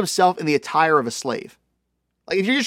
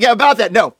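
dismissive; 6 semitones higher, 8 dB louder than usual. Acted. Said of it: "just swats it away".

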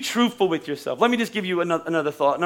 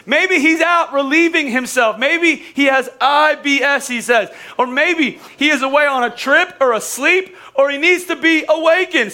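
mock taunt; 7 semitones higher, 4 dB louder than usual. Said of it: "I'm like".

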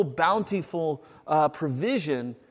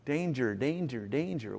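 repeated danger, falling and softer each time; warning; 4 semitones lower, 6 dB quieter than usual. Shown as a demonstration. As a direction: sound the alarm, quietly. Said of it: "when I see the word beware".

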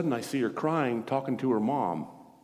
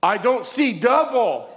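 high, loud, slow exclamation; acted amazed outcry; 10 semitones higher, 6 dB louder, slower than usual. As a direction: cry it out as her astonished discovery. Acts out woman's amazed repeat.

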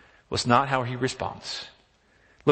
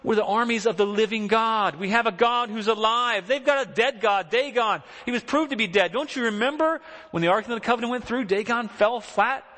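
a touch of indignant pride, pitched up; mild indignant David; 8 semitones higher than usual. Retold or imitated. Preacher voicing David, mildly indignant, pitched higher. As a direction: say it as if insulted that anyone doubts it. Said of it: "he's saying".

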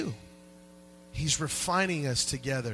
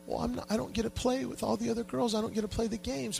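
lightly voiced upset little girl, higher; a hint of a small girl complaining; imitating little daughter; 8 semitones higher, 3 dB quieter than usual. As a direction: pitch up for a tearful child. Daughter voiced crying at party.

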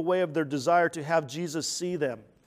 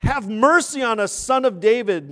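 loud, pleading shout, higher pitch; booming acted plea for mercy; crying out; 5 semitones higher, 13 dB louder than usual. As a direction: cry out to be heard over a crowd. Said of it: "they cried out".

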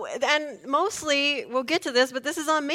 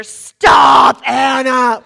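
very loud, drawn-out yell; imitated scream; 15 dB louder, much slower than usual. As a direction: bellow it right in the driver's ear. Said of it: "screaming in Anna's ear".

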